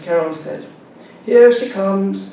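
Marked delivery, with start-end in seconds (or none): 1.3-2.3 loud, slow nursery-rhyme chant; loud sing-song. A narrator's reading. Old mother chants a teasing rhyme aloud to the boys. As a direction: call it out slow like a playground rhyme. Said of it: "With a loud voice".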